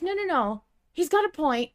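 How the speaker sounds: normal talking voice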